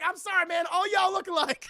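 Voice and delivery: loud, high defensive shouting; yelled apology. Retold or imitated. Carter to his partner after punching him, defensive shout. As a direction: shout an excuse over the brawl.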